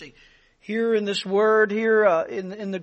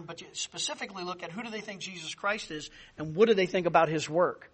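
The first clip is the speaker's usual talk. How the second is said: ordinary talk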